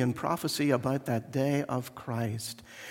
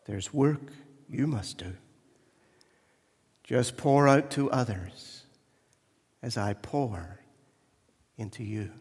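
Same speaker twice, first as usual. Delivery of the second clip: kindly; 2 semitones lower than usual. Acted. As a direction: speak gently to the mended vessel. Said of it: "Spoke to it kindly".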